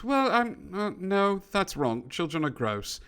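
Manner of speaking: British gentleman, starting high and settling; lightly voiced disagreeing British man; British accent. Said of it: "the British man goes".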